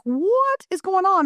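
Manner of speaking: high, drawn-out mock demand; imitated alarmed husband. Husband comes upstairs to the hollering, imitated slow high demand. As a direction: lightly act out a bewildered husband.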